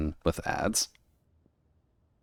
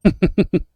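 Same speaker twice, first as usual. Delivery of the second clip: laugh; 14 semitones higher, 9 dB louder than usual. Real, not acted. Laughs at joke.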